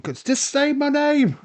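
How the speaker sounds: high, loud, drawn-out yell; mock scream. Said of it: "But it's screaming".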